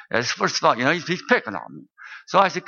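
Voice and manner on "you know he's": usual speaking voice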